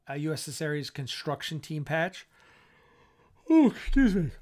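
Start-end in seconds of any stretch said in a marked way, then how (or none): 3.5-4.3 yawning. Real, not acted. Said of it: "keep yawning 'cause I'm exhausted".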